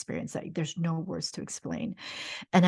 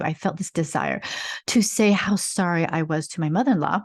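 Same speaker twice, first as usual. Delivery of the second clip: ordinary talk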